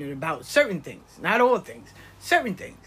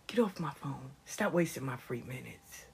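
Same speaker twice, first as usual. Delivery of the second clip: whispering; 5 semitones lower, 9 dB quieter than usual. Retold or imitated. Mother cuts off daughter.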